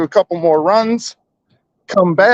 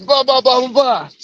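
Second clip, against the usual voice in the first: mocking blah blah; 9 semitones higher than usual. Mocking the boyfriend.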